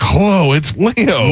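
put-on high, slow old man greeting; old uncle voice. Host imagines the uncle calling an old lady, high and slow. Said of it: "he's like".

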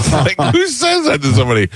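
incredulous outburst. Real, not acted. Host telling cohosts about son's seatmate, high incredulous outburst. Outraged at stranger's question.

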